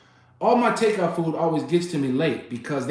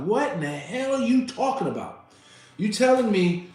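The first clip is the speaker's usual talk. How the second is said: retold yelling; 7 semitones higher than usual. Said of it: "this is where I'm yelling".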